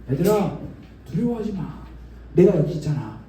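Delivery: gently calm frightened friends; reassuring. Acted. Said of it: "제자들을 토닥토닥, 안심시켜 주셨어요".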